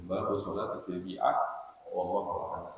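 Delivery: soft, low prayer under the breath; murmured closing prayer. Real, not acted. Preacher closing the lesson, softly murmured prayer. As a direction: wind down into a quiet Arabic supplication.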